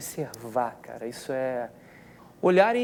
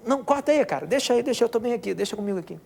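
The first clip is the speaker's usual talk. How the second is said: emotional refusal; 8 semitones higher, 4 dB louder than usual. Retold or imitated. Retells his overwhelmed refusal.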